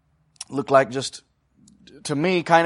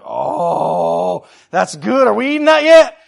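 long swooning "oh" climbing into a high, loud question; hammed-up craving for food; hungry delight; 6 semitones higher, 11 dB louder, much slower than usual. Walker smelling a pie baking on the way home, hungry delight.

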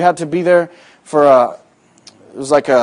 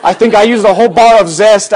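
amused exaggeration; 5 semitones higher, 5 dB louder than usual. Real, not acted. Joking about scrubbing.

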